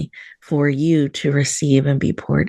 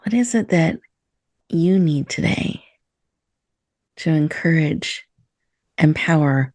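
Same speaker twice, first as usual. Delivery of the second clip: guided meditation. Acted. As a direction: ease listeners into stillness, breath by breath. Leads a breathing meditation.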